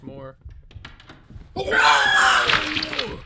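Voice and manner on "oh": scared scream. Real, not acted. Jump scare.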